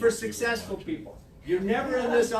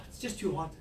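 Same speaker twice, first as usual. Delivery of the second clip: sighing, lower, softer mock complaint; weary excuse-making; 4 semitones lower, 8 dB quieter than usual. Coach mimics agent making excuses, weary sigh. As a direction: sigh and give up before trying.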